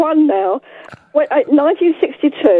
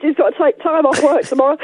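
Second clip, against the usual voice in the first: usual speaking voice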